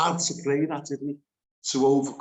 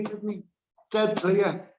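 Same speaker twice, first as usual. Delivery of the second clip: ordinary delivery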